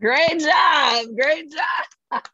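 loud, high cheering; excited praise. Real, not acted. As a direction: cheer her on. Cheering host.

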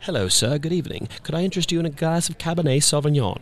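imitating a polite British bartender; British accent. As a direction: bartender Min offering wine, in a lightly done British accent. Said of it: "he's British".

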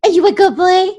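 high, loud puppy voice; talking to a dog. Acted. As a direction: coo it like to a puppy. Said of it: "or the puppy voice".

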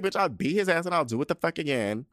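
shrieky-high mock confession, gleefully insistent; defiant glee. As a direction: crow it proudly with no remorse. Repeats defiant imagined confession.

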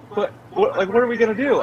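high, stammering mock worry; high-pitched retold fretting; imitated panic. Panicked wife's question, retold.

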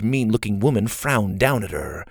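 ordinary delivery, nothing marked

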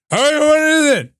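put-on very high, loud, impatient old man; hammed-up doddering old-timer; Joe Biden. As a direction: burst out like a drunk old president. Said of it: "That's my Joe Biden impression".

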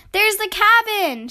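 high, loud shout of discovery; excited shout. A narrator's reading. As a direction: call it out the moment you spot it. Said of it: "shouted Sister".

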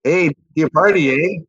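light Canadian twang, ending on eh; a hint of a Canadian accent. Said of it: "slight Canadian twang you've got there".

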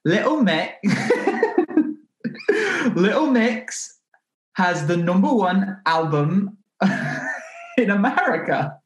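mock British accent, drawn out through the giggles. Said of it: "So that's my [name]".

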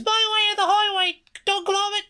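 squeaky mock British girl, nearly breaking; British girl accent. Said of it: "a little too masculine for the character".

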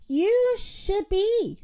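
high, slow, indignant reply; scolding rooster. A narrator's reading. Rooster scolds Moo after apology.

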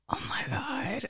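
soft unvoiced croak; sick whisper. Retold being very sick.

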